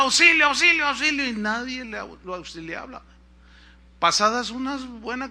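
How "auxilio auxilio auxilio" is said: high, louder mock shout for help; retold cry for help. Fallen climber shouting from the ravine, retold cry for help. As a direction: call out urgently as the fallen climber. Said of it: "Y él gritaba".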